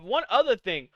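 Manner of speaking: ordinary talk, nothing marked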